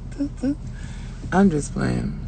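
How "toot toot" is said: small, high, soft toot-toot; car horn imitation. Mocking other people's horns, high soft toots. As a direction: toot like a feeble little horn. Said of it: "y'all horn sound like that song".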